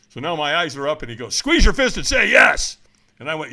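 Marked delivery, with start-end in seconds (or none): 1.4-2.8 high, loud coaching command; imitated trainer yelling, high and forceful; trainer's shout. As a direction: fire them up with a shouted order. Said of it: "he screamed at me".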